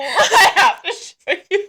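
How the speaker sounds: high, slow words through laughter; real giggling fit; laughing hard. Real, not acted. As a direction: squeeze it out between laughs. Still helpless from laughing.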